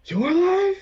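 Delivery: high, loud incredulous question; astonished cry. Acted. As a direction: cry out in disbelief. Astonished reunion in character.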